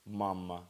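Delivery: Mama said clearly, MA-ma, stress on the first syllable; teacher modelling Mama for students to repeat; model pronunciation. Shown as a demonstration. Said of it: "The first one, simple".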